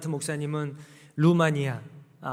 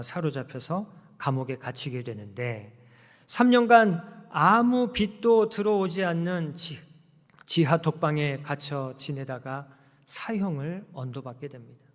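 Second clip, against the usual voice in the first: ordinary talk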